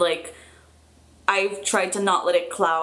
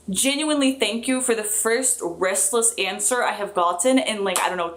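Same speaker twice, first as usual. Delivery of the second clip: ordinary talk, nothing marked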